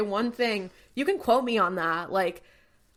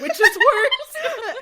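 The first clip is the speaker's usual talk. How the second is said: high, loud, drawn-out through laughter; laughing; 7 dB louder, much slower than usual. Real, not acted. Podcaster cracking up at co-host's joke, laughing. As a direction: crack up while asking.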